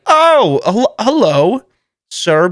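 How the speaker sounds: high, loud, stammered hello; startled greeting. Retold or imitated. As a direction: re-enact being startled by a stranger behind you. Acts out being startled by stranger.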